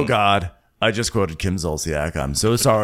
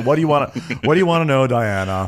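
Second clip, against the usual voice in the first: higher, louder mock question; imitated retort; 4 semitones higher, 5 dB louder, faster than usual. Recapping her retort to Diana, mock-impatient. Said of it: "So she's like".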